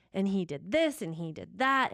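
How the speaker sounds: ordinary delivery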